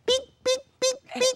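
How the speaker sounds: very high cooing beeps; the mother cooing at the baby. Retold or imitated. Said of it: "picks up the baby and goes".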